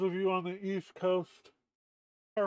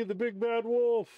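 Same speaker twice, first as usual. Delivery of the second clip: higher, louder hammed-up wolf announcement; big bad wolf; 6 semitones higher, 4 dB louder than usual. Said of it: "it's me".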